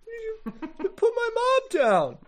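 high-pitched wail, dragging at the end; upset Teddy. Acted. Teddy confronting the attacker holding his mom, high tearful wail. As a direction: plead in a high, tearful boy-in-trouble voice. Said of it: "Teddy comes at you like".

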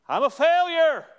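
high, loud anguished wail of a parent; anguished cry. Retold or imitated. Preacher voicing a despairing parent, loud high anguished cry. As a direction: cry out as a parent who feels they failed.

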